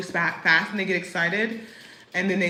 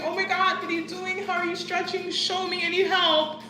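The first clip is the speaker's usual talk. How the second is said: imitating an excited beach lady, higher voice; excited stranger; 10 semitones higher than usual. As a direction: gush like a stranger begging for help. Beach lady asking for help.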